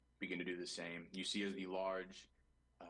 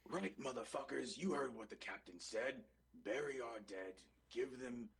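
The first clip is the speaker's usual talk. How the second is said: higher-pitched crew boss giving orders; rallying command; 6 semitones higher than usual. Acted.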